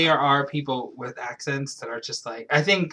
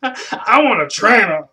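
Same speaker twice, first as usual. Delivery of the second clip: mock customer voice; 6 semitones higher, 7 dB louder, slower than usual. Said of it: "acted quote of gym customer".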